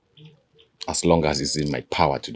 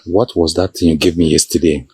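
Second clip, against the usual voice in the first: teasing; 8 dB louder than usual. Acted. King teasing in-law about herbs.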